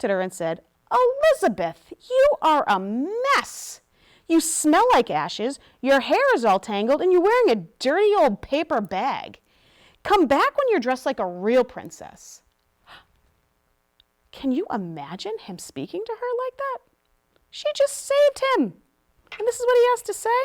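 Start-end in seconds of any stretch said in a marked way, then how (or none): 0.9-12.4 snooty scolding. A narrator's reading. Prince scolds rescuer for looking messy, loudly disgusted. 14.3-20.3 high, incredulous protest; indignant. Real, not acted. Reader to children about ungrateful prince, indignant.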